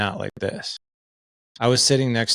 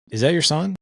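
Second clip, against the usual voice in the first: pointed question; 8 semitones higher than usual. Retold or imitated. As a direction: call her out on it, sharply. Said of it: "And I'm like".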